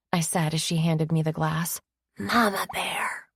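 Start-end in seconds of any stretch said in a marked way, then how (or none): 2.2-3.3 poor stage whisper. A narrator's reading. Kristen teasing her friend, a poor stage whisper. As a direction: whisper it badly so everyone hears. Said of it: "Kristen whispered very poorly".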